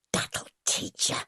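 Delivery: low hissed spite, slow; spat. A narrator's reading. Nellie spitting at young Edie, hissed and slow. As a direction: spit the words with loathing. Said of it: "she spat at Edie".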